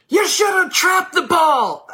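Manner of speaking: loud, high, drawn-out shout; yelling from the sideline. Acted. Parent yelling at their kid on the field, loud sideline shout. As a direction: yell it slowly and high across a field. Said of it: "Why would you yell it?".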